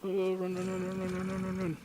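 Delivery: high, loud frantic repeats; panicked running. Real, not acted. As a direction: yell it in a panic. Fleeing zombie in panic.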